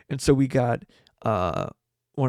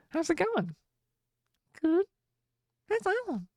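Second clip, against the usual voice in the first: very high-pitched mock small talk; girlfriend's bit voice. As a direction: squeak out both sides of a chat, very high. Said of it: "doing, like, this voice".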